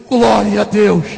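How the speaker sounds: slow, loud cry of praise; louvor imitado. Retold or imitated. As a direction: imitate a kneeling wife's praise, slow and strong. Esposa louva de joelhos. (louvor lento e forte)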